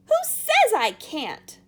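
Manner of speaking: put-on furious spoiled girl; shout. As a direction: scream defiance at Wonka. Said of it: "shouted Veruca".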